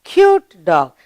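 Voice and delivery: usual speaking voice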